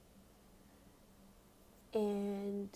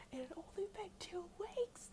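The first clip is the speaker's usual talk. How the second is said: much higher, squeaky-pitched disbelief; incredulous; 10 semitones higher than usual. Real, not acted. Teller stressing how short it was, incredulous high pitch.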